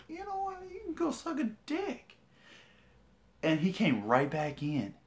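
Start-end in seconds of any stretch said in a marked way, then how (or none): 0.1-2.1 taunting falsetto voice; high-pitched taunt. Retold or imitated. Man taunts the principal walking out, high mocking voice. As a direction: toss it after him in a high, mocking voice.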